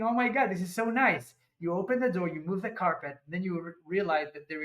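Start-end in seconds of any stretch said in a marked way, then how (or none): 0.0-1.3 higher-pitched gush of admiration; imitated wide-eyed delight; delighted reaction. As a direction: voice a naive, pleasantly surprised first reaction. Voices the reaction on entering.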